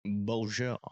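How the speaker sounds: Beaugier in a light French accent; a hint of French on Beaugier. Shown as a demonstration. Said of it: "I would have to say that with a French accent".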